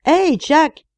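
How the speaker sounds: high, loud call down a shaft; shouting. A narrator's reading. Young miner leaning over the landing, shouting down to his friend. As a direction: call out to a friend far below. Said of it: "cria-t-il".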